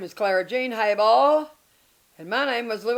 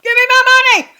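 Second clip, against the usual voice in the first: much higher and louder, yelling for the money; shouting demand; 17 dB louder, much faster than usual. Retold or imitated. To her new husband, shouting for her money. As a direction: bellow it like a furious bride.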